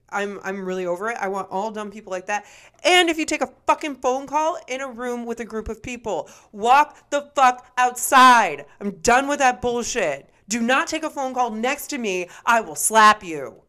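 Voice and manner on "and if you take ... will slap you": angry rant. Real, not acted. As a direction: yell it out, fed up. Ranting about phone calls.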